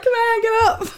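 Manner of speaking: high laughing call. Retold or imitated. Quoting herself, laughing.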